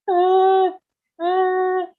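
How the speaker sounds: high, loud mock whine of a child in a trolley; whining child. Mother imitating a child whining in the shop trolley, high mock whine. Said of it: "and they go".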